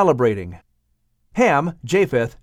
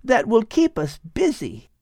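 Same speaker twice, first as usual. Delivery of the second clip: put-on higher young woman's voice, far from the narrator's; lively young woman; Ada's voice; 13 semitones higher than usual. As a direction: voice Ada eagerly planning the party. Ada speaks in story.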